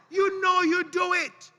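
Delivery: high, loud, laughing accusation; laughing tease. Real, not acted. Preacher laughing at the congregation, high and loud. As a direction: call them out through a laugh.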